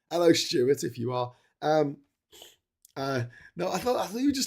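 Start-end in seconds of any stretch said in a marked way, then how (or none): none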